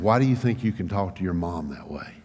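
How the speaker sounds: low, hushed reproach; quietly stern, pitched well down; serious father. Retold or imitated. Father questioning his son's talk to mom, low and serious. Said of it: "then I knew it was serious".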